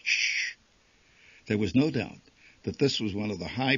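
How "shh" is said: hissed shush. A narrator's reading. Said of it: "indignant patriots hissed back".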